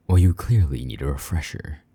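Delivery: usual speaking voice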